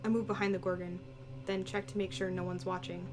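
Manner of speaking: normal talking voice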